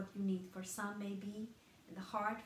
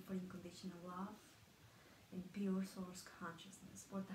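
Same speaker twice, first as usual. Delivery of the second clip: soft, hushed prayer to begin a meditation; meditative invocation; 7 dB quieter than usual. Acted. Healer opening a group meditation with an invocation, soft and hushed. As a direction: ease into a quiet, reverent invocation.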